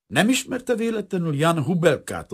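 plain reading voice

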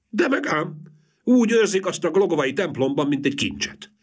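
high, emphatic woman's voice; high wife boasting; proud gossip. A narrator's reading. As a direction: assure him proudly. Wife speaks in character.

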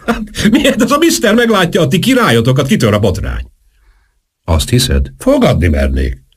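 high-pitched incredulous scoffing; scornful. A narrator's reading. Valmier mocks Marcel's friend posing as king, scornfully. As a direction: ridicule the whole idea in a high, agitated voice.

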